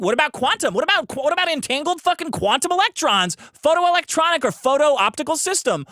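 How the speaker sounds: loud, high-pitched, incredulous ranting; exasperated rant. Real, not acted. Streamer ranting about a dumb statute's list, exasperated. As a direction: rail at the absurd wording, voice pushed way up.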